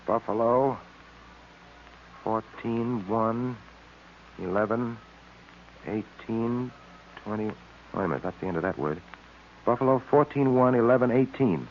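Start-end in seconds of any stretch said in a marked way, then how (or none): 0.1-9.0 low, soft mutter while decoding; quiet, lower voice working through a code; muttering to himself. Acted. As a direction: puzzle it out under your breath. Tracy decodes alone, muttering.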